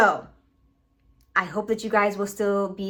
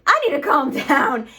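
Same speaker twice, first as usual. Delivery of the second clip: louder, worked-up repeat with a laugh; fail to calm down, pushing it out loud; 3 semitones higher, 5 dB louder than usual. Real, not acted. Vlogger failing to stop her rant, worked-up laughing.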